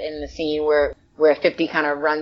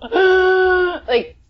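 overwhelmed gasp; 12 semitones higher, 5 dB louder than usual. Retold or imitated. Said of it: "almost turned into a puddle".

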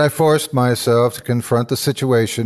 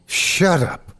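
hollow tremulous; 6 semitones higher than usual. Acted. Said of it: "came out hollow and tremulous".

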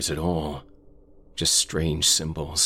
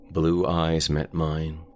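usual speaking voice

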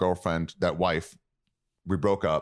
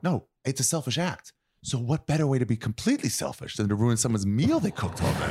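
mock earnest reasoning; 7 semitones higher than usual. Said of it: "I'm like".

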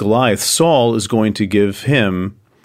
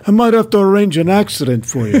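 high, menacing mock mob boss; Sopranos mobster; 10 semitones higher than usual. Joking about royal rivals, a playful mock mobster threat. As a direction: ham up a Sopranos-style threat. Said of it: "It's like The Sopranos".

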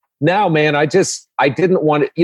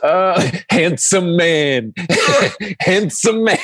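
laughing; 7 semitones higher, slower than usual. Real, not acted. Laughing at the Pryor joke.

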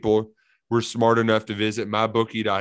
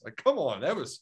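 ordinary talk, nothing marked

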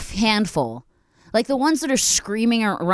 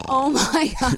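high gasp then oh my God; sharp gasp; 6 semitones higher, much slower than usual. Real, not acted. Friend hearing the dad story, high startled gasp. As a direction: gasp in real shock. Reacts to crying in front of dad.

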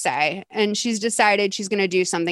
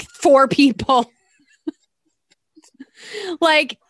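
ordinary talk, nothing marked

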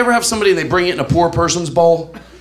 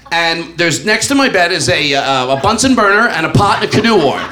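usual speaking voice

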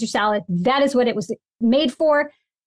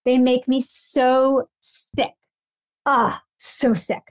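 slow, drawn-out disgust; much slower than usual. Real, not acted. Slow, drawn-out disgust at sunchokes. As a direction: stretch every word out in disgust.

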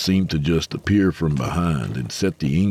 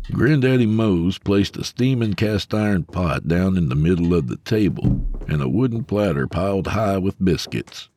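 usual speaking voice

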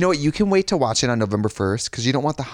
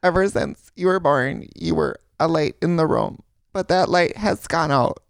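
higher, slow, mock Wisconsin accent read; strong Wisconsin accent; 5 semitones higher, slower than usual. Imitating a family member's intervention letter, strong Wisconsin accent. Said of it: "the accent's, like, so strong".

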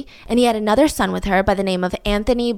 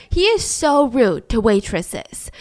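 ordinary talk, nothing marked

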